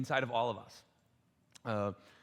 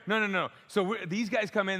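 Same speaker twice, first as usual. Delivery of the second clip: ordinary talk, nothing marked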